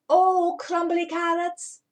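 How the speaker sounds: slow, loud, high cross exclamation; a grumpy rabbit huffing at bad news. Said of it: "said Mister Rabbit crossly".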